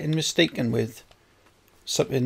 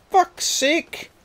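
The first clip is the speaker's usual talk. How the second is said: exasperated curse. Real, not acted. Frustrated at game.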